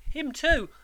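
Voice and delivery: put-on high-pitched, nervous child's answer; timid boy. A narrator's reading. Boy tells convict of dead father, timid.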